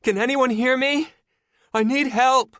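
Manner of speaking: high, loud, muffled plea; trapped woman calls through the elevator shaft; desperate woman calling. A narrator's reading. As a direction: shout up the shaft, scared and pleading. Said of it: "a desperate woman's voice call".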